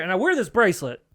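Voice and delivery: usual speaking voice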